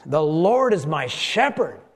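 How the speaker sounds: slow, emphatic declaration; measured outburst of praise; proclaimed outburst. Acted. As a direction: proclaim it slowly, drawing out each key word. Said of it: "he starts with an outburst".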